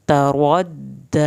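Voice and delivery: full heavy ر in تَرَدَّى, doubled د, long final ى; thick ر and stretched دَّى in تَرَدَّى. Shown as a demonstration. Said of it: "را کو پر کر کے موٹا پڑا جائے گا".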